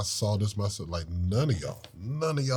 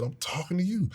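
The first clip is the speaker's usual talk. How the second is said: higher, pushy I'm talking to you; mock raised tone; 6 semitones higher, 7 dB quieter than usual. Husband imitating his old raised tone at his wife. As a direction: mimic your own old snappy tone. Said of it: "if I kept the same tone".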